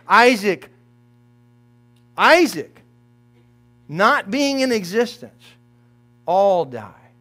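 usual speaking voice

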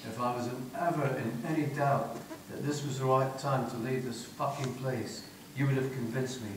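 put-on lightly posh Scottish or English accent, low and soft; a touch of posh accent, voice kept down. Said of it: "She says in this accent it's either posh, posh Scottish or English".

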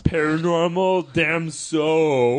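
slow, drawn-out reversed catchphrase; backwards, slow. Shown as a demonstration. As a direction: drag each word out slowly. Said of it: "catchphrase said backwards slowly".

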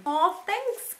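ordinary delivery

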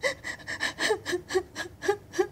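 long run of soft, high sobs; acted sobbing. A narrator's reading. Girl crying up a tree, acted sobbing. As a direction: cry in repeated high catches. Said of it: "she cried and cried".

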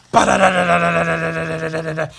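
loud, fast rattling gunfire sound; roaring mock machine-gun burst; machine-gun noise. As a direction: shout it like a gun firing. Voicing machine-gun fire in comic.

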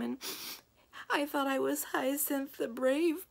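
put-on high, slow, teary little girl voice; choked-up child, high and slow; tearful Hyacinth. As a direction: sob out the story as a hurt young girl. Hyacinth crying through her story.

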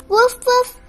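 high, bright yaps; puppy barks. Acted. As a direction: yap like a relieved puppy.

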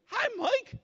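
high, bright mock-friendly hello; a high, chummy hello from the condemned man; creepy friendly greeting. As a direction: greet him sweetly like an unwanted friend. Condemned man greets you by name.

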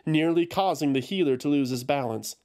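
usual speaking voice

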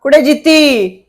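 long, high, drawn-out call across the house; calling out loud. Acted. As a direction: shout her name slowly from afar. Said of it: "ਤੂੰ ਐਡੀ ਉੱਚੀ ਉੱਚੀ ਬੋਲ ਮਾਰੀ ਜਾਂਦੇ". (ਉੱਚੀ ਹਾਕ ਮਾਰ ਕੇ ਬੁਲਾਉਣਾ)

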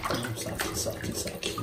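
very soft, breath-only hushing; soothing whisper. Real, not acted. Owner hushing an animal during washing, whispered. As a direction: whisper calm to a fidgety pet.

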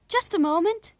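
put-on high, loud boy calling; young Willie's voice. A narrator's reading. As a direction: pipe up eagerly to hold them. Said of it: "said Willie".

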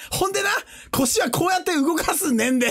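comic Osaka-dialect instruction; mock Osaka dialect. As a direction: coach someone in joking thick Osaka dialect. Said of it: "大阪弁のジジイみてえな".

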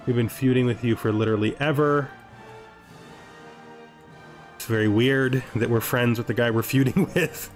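normal talking voice

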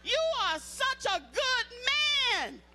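slow, high, syrupy forced sweetness; sugary praise through anger. Shown as a demonstration. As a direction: sweetly praise him while fuming. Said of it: "when he make you mad, say".

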